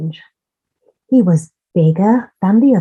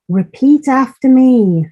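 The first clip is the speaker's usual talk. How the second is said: higher, louder motherly instruction; mother duck teaching; 6 semitones higher, 5 dB louder than usual. A narrator's reading. Mother Duck teaching her ducklings to quack, raised voice. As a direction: lead the class of ducklings. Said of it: "said Mother Duck".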